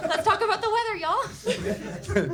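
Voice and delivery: high, loud, hasty teacher pivot; awkward topic change. Retold or imitated. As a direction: rush to change the subject.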